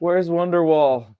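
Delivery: dazed prisoner crying out for it; loud demand. Acted. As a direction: call out, hammed-up and bewildered. Dazed prisoner calls for song.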